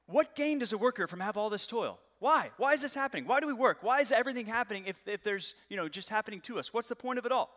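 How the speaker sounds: hammed-up despair at toil; exasperated questioning. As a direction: Solomon crying out why. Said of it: "And then he says".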